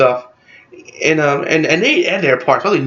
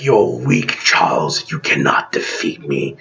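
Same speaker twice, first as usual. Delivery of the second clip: low, slow, menacing villain, much lower by the end; 10 semitones lower, slower than usual. Retold or imitated. Villain taunting Charles in a low, menacing voice. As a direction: growl a threat at an old enemy. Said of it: "He's like".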